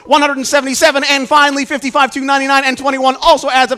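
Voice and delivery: building showman excitement. Acted. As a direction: reel off the numbers like a triumphant showman, climbing to the climax. Rapid-fire magic square reveal finale.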